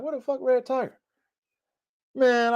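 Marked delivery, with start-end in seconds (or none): 0.0-1.0 high, softer searching call; searching for the dog. Retold or imitated. Still looking for Red Tiger outside, a high softer call. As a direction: call out again, still worried. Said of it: "I'm like".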